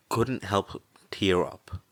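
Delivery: plain reading voice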